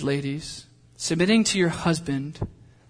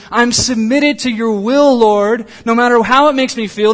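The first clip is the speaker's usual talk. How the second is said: fervent preaching; 5 semitones higher, 8 dB louder than usual. Acted. Preacher voicing a believer's surrender, loud and fervent. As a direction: preach it out like a vow to God. Imagined prayer, preached at full force.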